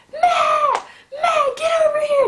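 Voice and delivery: very high, loud mock scream for help; retold scream. Labouring woman screams for husband from bathroom, high-pitched yell. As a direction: re-enact screaming for your husband. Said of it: "I started screaming".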